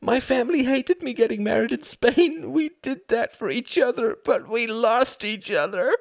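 high, strained lament; anguished. Acted. Anguished wife in a quarrel.

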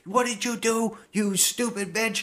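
high hammed-up panic; fake-frantic husband losing it; mock panic. Husband panicking at his wife, high mock frenzy.

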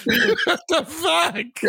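drawn-out, high, laughing words; slow, high-pitched incredulous laugh-talk; laughing disbelief. Real, not acted. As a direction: wheeze it out through laughter. Cracking up at the mix-up.

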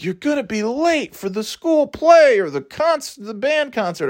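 high-pitched imitation of a yelling mother; mock yelling mom. Mom yells at her daughter in flashback, mock yelling. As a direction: scold in a hammed-up high voice. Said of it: "the mom's yelling at the daughter".

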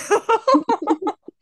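loud high burst of laughter; high giggle. Real, not acted. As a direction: crack up at yourself. Laughing at own British accent idea.

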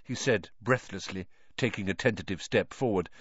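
usual speaking voice

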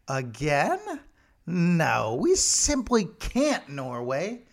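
lightly hammed polite Brit, rising high on "simply"; a touch of a prim English gentleman saying no thank you; mock posh refusal. Britain refusing the tree again.

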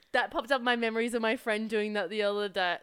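ordinary delivery

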